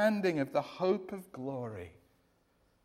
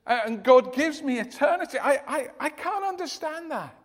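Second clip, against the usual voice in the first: raised, puzzled protest, a bit louder; baffled objection; 7 semitones higher, 7 dB louder than usual. Retold or imitated. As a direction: play the doubter who can't believe grace is free. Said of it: "that's the thing they go".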